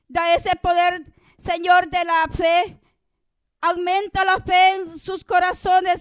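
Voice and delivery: loud, insistent intercessory prayer; fervent prayer. Real, not acted. Prayer leader begging the Lord to heal the suffering, fervent prayer.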